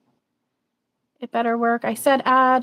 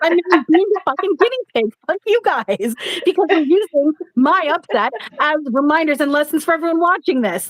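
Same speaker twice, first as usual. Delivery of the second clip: much higher, fast hammed-up fury at the spirits; mock angry rant; 10 semitones higher, 4 dB louder than usual. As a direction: play-act a furious tantrum, pitched high. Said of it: "but now I'm going".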